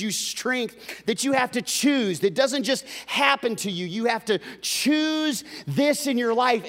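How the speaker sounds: loud, high, fervent preacher's cry; rising into a sung, stretched preacher's call; full-force preaching. Acted. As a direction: preach it out to the back row.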